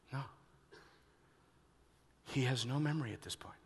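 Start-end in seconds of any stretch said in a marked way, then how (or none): 2.2-3.5 fast hushed whisper; quick whispered aside. Real, not acted.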